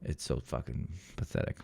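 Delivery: whispered contempt. Real, not acted. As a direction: breathe it out as a sneer. Mocking whisper about guy.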